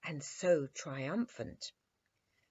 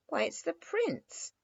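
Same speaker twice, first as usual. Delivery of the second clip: high, surprised correction; a girl amazed to see her playmate; 12 semitones higher than usual. A narrator's reading. Dimples corrects the soldiers, high and surprised. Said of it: "repeated Dimples".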